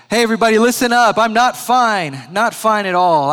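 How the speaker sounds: loud, fast call to the whole room; yelling out. Retold or imitated. Man yelling to a staring room, loud and fast. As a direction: shout it out to get everyone's attention. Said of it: "he yells out to everyone looking".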